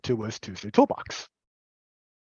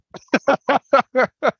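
burst of laughter; 12 semitones higher, 9 dB louder than usual. Real, not acted. Laughs at own story.

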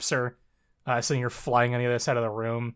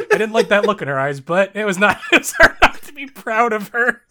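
laughing while reading; 7 semitones higher, 7 dB louder than usual. Real, not acted. Co-host reading a favourite story line aloud, laughing. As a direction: read it out through laughter, high and loud.

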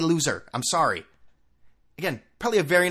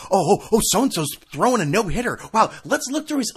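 mock eagerness; 7 semitones higher, 5 dB louder than usual. Mocking tweet-diggers excitedly.

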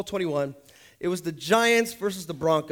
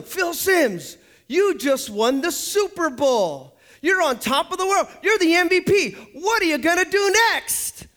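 high, shouted post-game question; TV interviewer; 9 semitones higher, 4 dB louder, slower than usual. Acted. Interviewer asking the Super Bowl winner what's next, high and excited. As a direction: call it out like a hyped-up sports interviewer. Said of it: "And they asked him".

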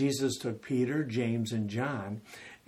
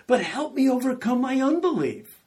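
high, loud desperate cry; father crying out his unbelief to Jesus, high and loud; 12 semitones higher, 8 dB louder than usual. Retold or imitated. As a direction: cry out the plea, rising higher. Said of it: "The father instantly cries out".

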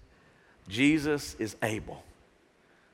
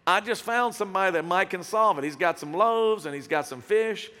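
louder, mock-helpful sarcasm; sarcastic; 4 semitones higher, 4 dB louder than usual. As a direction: needle him with a fake-eager offer, dropping on the name. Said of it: "Andrew kinda sarcastically comes up and goes".

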